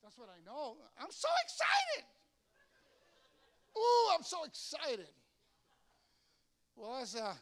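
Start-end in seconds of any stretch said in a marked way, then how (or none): 1.0-5.1 high, gushing, drawn-out delight; mock gushing excitement; gushing "so excited". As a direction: squeal it out like a thrilled fan. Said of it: "You say it all the time".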